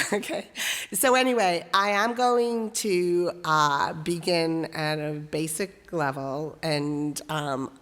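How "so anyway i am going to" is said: usual speaking voice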